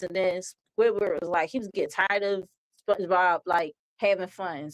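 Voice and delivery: normal talking voice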